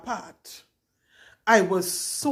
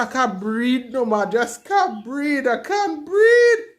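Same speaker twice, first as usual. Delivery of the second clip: panicked sobbing plea; 5 semitones higher, 6 dB louder, slower than usual. Retold or imitated. Reenacting labour panic to nurse.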